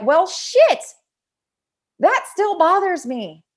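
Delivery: exasperated realization. Retold or imitated. An old hurt resurfacing.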